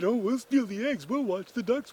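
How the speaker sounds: high, mimicking sisters' voice; scheming sisters.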